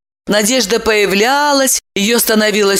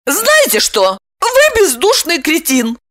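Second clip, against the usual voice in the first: high, squeaky indignation; pitched-up tearful retort; offended woman; 9 semitones higher than usual. A narrator's reading. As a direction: hurl the insult in a high voice. Wounded woman insulting him back.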